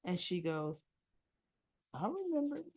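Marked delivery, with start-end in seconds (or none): 1.9-2.6 soft, high, lilting coy reply. Retold or imitated. Woman to departing suitor about hot property, coy. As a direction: say it softly with a lifted, knowing lilt.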